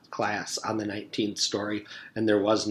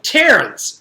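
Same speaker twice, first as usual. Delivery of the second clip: loud, higher sharp scolding call; sharp rebuke; 12 semitones higher, 11 dB louder than usual. Acted. Teacher scolding Terrence for rudeness, sharp rebuke. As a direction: snap the boy's name in anger.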